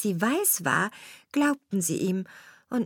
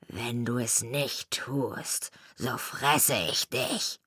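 wolf's threat; 8 semitones lower, 5 dB quieter, slower than usual. A narrator's reading. Wolf threatens the miller.

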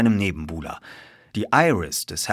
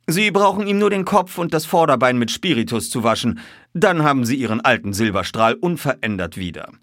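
high-pitched, louder, assured detective voice; composed detective, explaining; 6 semitones higher, 5 dB louder than usual. A narrator's reading. Detective revealing the disguised horse to colonel, assured. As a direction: explain it with unruffled confidence.

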